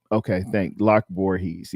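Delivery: normal talking voice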